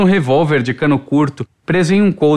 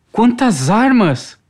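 higher, slow amazement; awestruck exclamation; 6 semitones higher, much slower than usual. A narrator's reading. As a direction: stretch it out, stunned. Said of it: "exclamou boquiaberta". (espanto, boquiaberta)

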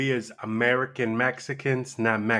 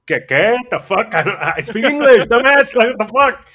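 higher, louder confused rant; exasperated retold outburst; 10 semitones higher, 10 dB louder than usual. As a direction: act baffled and fed up, voice rising. Confused by Spanish-speaking cousins.

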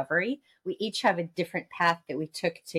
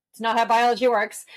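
louder, higher firm put-down; emphatic retort; 5 semitones higher, 6 dB louder than usual. Retold or imitated. Coach firmly corrects a client's fear of rest. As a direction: set them straight, loud and sure. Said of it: "I'm like".

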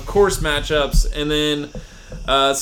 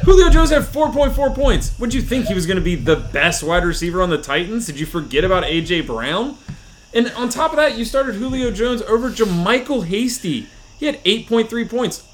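mock scolding; 6 semitones higher than usual. Roasting friend's lineup.

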